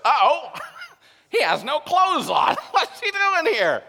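high, loud mock shock broken by laughter; laughing mock reaction. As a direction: laugh through the imagined gasp of the congregation. Mock reaction to a naked churchgoer.

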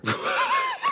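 very high shriek of laughter; real laugh. Real, not acted. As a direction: burst into a squealing laugh. Laughing at own joke.

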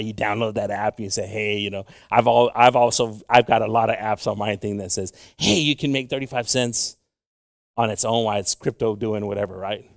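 ordinary talk, nothing marked

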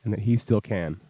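slow, soft, heavy with meaning; mock deep sincerity. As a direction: play it like a solemn heart-to-heart. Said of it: "It's deep".

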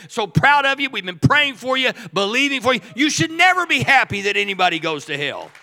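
roaring sermon climax; full-force preaching. Acted. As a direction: lift the room, shouting the good news.

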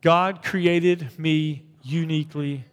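"God created me uniquely" drawn out; slow recited affirmation; slow unison declaration. Shown as a demonstration. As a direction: lead the church slowly. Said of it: "You ready to say that with me?".

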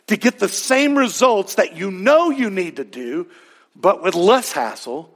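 ordinary delivery

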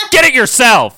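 high, loud mock snap; Emma. Emma refusing to get a present, high loud retort. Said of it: "Emma's like".

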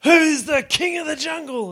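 high, loud lion roar; a lion bellowing at the monkey; lion's roar. Acted. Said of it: "he roared and said".

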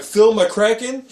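"Phil McCracken" high, loud and drawn out, rising; incredulous pun emphasis. Retold or imitated. Husband points out the pun to wife, incredulous high "Phil McCracken". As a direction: spell out the joke by stretching "Phil McCracken" into a question.

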